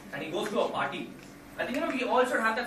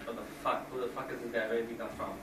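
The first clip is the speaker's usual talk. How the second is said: lower, softer Godfather mumble; Brando voice; 8 semitones lower, 5 dB quieter than usual. Retold or imitated. Godfather scoffs at villain in low mumble. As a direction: growl it low like an old mob boss. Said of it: "Brando's a little, you know, like".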